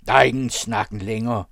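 loud, embittered hunter. A narrator's reading. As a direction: cut off the pleading firmly and loudly. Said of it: "svarede den forbitrede jæger". (forbitret og højlydt)

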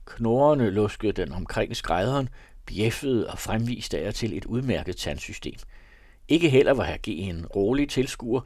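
usual speaking voice

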